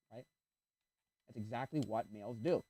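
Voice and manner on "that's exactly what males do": usual speaking voice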